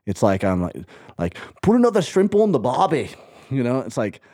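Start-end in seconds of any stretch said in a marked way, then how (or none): 1.6-3.1 put-on clumsy Australian accent, pitched higher; a hammed-up Australian accent; bad Australian accent. Showing why her Australian accent fails, a bad Australian accent. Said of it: "tried so hard to get me to have a good Australian accent".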